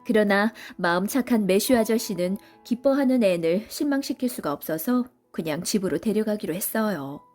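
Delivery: ordinary delivery, nothing marked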